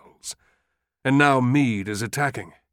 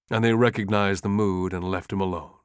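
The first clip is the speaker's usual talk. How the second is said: ordinary delivery, nothing marked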